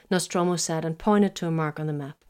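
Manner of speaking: plain reading voice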